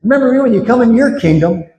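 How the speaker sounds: a hint of the desperate criminal; criminal's plea. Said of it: "And he says".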